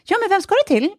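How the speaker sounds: high-pitched insistent question; acting out a polite, unbudging challenge; friendly but firm. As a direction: press him brightly, ignoring his evasive answer. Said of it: "låtsas inte om det utan fortsätter".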